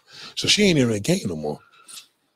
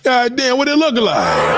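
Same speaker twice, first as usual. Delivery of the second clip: much higher, louder Goddamn drawn out; hammed-up amazement; 11 semitones higher, 3 dB louder, slower than usual. Host replaying his reaction for the audience, hammed-up awe. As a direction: replay it bigger, as a dumbfounded gasp.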